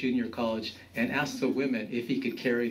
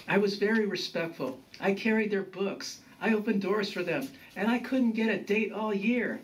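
higher-pitched grandson listing grievances; complaining; 9 semitones higher than usual. A narrator's reading. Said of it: "complained Juan".

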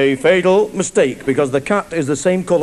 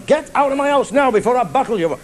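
angry father; 8 semitones higher than usual. Acted. Father threatens lad.

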